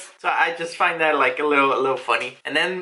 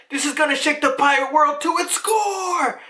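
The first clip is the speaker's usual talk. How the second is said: high, sustained hype cry; rally the crew like a rowdy pirate; 9 semitones higher than usual. Acted. Pirates excited as they set sail, rowdy rallying cry.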